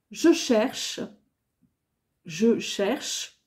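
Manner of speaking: usual speaking voice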